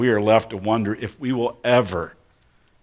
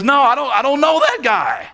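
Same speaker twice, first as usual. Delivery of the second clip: much higher, strained voice stretched thin; desperate denial; 4 dB louder than usual. Acted. Peter denying Jesus again, very high strained protest. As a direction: deny it with rising panic. Peter denies a second time.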